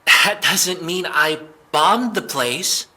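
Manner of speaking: cornered suspect, rattled and pleading; flustered protest. Acted. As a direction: scramble to defend yourself.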